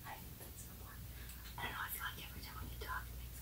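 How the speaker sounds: fast nervous whisper. Real, not acted. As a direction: whisper it quickly. Hushed talk in dark basement.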